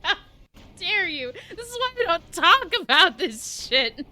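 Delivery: loud, high mock outrage breaking into laughs; crying with laughter. Real, not acted. Streamer scolding chat, laughing outrage. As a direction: scold them while losing it.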